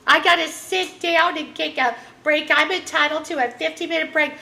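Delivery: high, mocking Karen voice. Mocking a Karen who wants her break, high put-on voice. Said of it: "Oh, you, you Karens".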